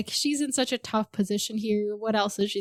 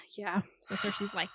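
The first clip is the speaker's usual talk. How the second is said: ordinary talk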